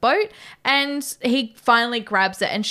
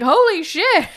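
loud, high "holy shit" outburst; mock shocked exclamation; 8 semitones higher, 5 dB louder, slower than usual. Co-host quoting a stunned film character, mock shocked exclamation. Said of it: "He's like".

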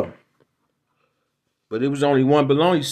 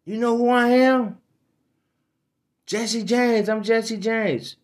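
much higher, boastful outlaw voice; mock Jesse James; 10 semitones higher, slower than usual. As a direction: puff up and brag, high and drawn out. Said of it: "what did he say?".

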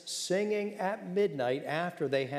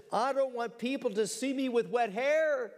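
higher-pitched mock complaint; mock excuse; 7 semitones higher than usual. Preacher imitating people who avoid baptism, mock whining excuse. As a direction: imitate a vain objector, voice pitched up.

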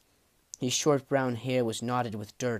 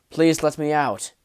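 blunt request, a bit louder; 3 semitones higher, 7 dB louder than usual. A narrator's reading. Prisoner asking goblin guards to let him out, bluntly. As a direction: ask it bluntly, without pleading. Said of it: "said Kethvar bluntly".

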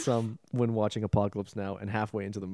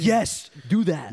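slow, high, grandly approving; drawn-out director voice; imitating Coppola; 6 semitones higher, 6 dB louder, much slower than usual. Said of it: "Coppola's like".

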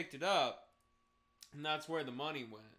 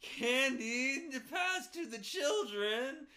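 high, slow pleading voice; whiny old patient; 11 semitones higher, 6 dB louder, much slower than usual. Retold or imitated. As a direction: plead like a confused old man. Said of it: "that person's gonna be like".

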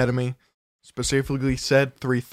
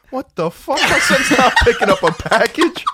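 much higher, louder indignant mom, laughing; mom yelling; 10 semitones higher, 6 dB louder than usual. Retold or imitated. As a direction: play the outraged mother shouting. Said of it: "She's like".

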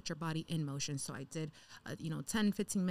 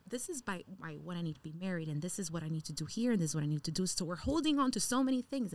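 normal talking voice

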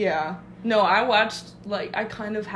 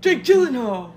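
excited; 3 semitones higher, 8 dB louder than usual. Real, not acted. Excited name call.

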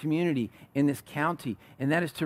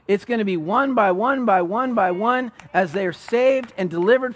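higher, driving sermon climax; impassioned preaching; 6 semitones higher, 5 dB louder than usual. Real, not acted. Preacher promising the church a changed community, impassioned. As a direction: preach it with building force, pitch held up.

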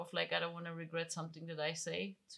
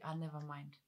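low, softer dismissal; angry brush-off; 4 semitones lower, 8 dB quieter than usual. Acted. Brushing someone off when angry, understated dismissal. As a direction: wave it away, anger held in. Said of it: "first reaction when I'm angry".

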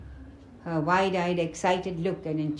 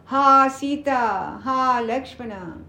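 slow, high, louder drawn-out call; faked cry of distress, stretched out; loud cry for help; 5 semitones higher, 5 dB louder, much slower than usual. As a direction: cry out as if dying and calling for help. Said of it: "he called out loudly".

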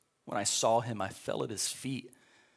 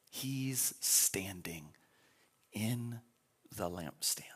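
whisper; 3 semitones lower, 4 dB quieter, much slower than usual. Acted. Preacher whispering scripture image.

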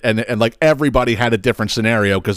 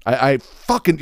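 ordinary talk, nothing marked

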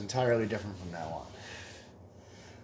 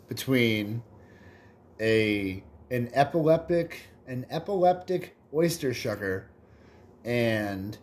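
ordinary delivery

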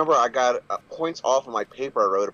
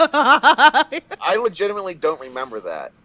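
high, loud, drawn-out protest; baffled insistence; 9 semitones higher, 7 dB louder, slower than usual. Real, not acted. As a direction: insist slowly, in utter bewilderment. Insists, baffled, amid laughter.

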